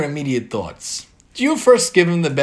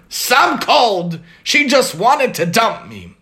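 angry mutter; 5 semitones higher, 4 dB louder, slower than usual. Acted. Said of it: "muttered Leah angrily".

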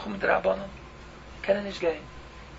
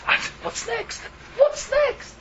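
alarmed question; 14 semitones higher, much slower than usual. Real, not acted.

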